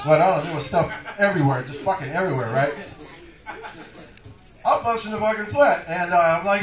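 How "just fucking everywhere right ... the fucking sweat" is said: normal talking voice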